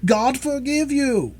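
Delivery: high, drawn-out slow wail; anguished cry. A narrator's reading. Mother reproaching her daughter, anguished high cry. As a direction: cry out in horror. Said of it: "cried Madame Charpentier".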